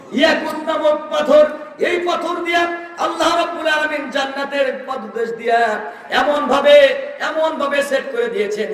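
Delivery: high, shouted sermon chant; fervent preaching. Real, not acted. Preacher describing paradise's precious stones, high fervent preaching. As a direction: ring it out high, stretching the phrase ends.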